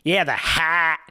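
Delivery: mock American with a hard vowel in "hat"; harsh American accent. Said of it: "it's so harsh".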